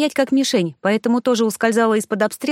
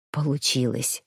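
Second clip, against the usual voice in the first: soft, much lower, weary confirmation; whispered; 8 semitones lower, 7 dB quieter than usual. A narrator's reading. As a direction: breathe it out low and tired. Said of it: "прошептал он". (устало, низким шёпотом)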